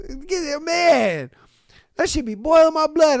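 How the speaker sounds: normal talking voice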